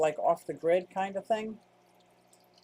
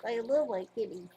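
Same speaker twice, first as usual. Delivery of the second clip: cooing pet talk; 4 semitones higher than usual. Real, not acted. Doting on the kitty, high cooing voice. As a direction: coo it up high for the cat. Cooing over her cat.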